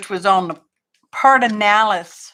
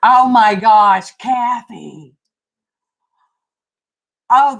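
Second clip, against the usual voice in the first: usual speaking voice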